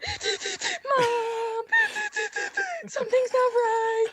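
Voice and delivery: high, wailing mock sob; imitating a crying child's wail; mock crying to mom. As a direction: whimper it like a hurt kid going to mom. Retelling crying to her mom.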